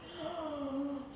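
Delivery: soft mock intake of breath; imitating the scandalized stranger, gasping; mock shocked gasp. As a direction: gasp in fake horror. Guy accusing her.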